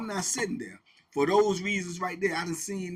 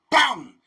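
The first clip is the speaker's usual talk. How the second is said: explosive, loud "boom"; imitated blow; 8 semitones higher, 13 dB louder than usual. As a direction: slam out a high impact noise.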